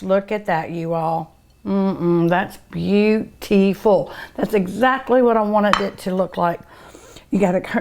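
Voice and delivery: ordinary talk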